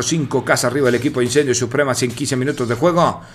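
ordinary delivery

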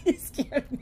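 giggling through the words; laughing. Real, not acted. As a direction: laugh as you say it. Laughing after his outburst.

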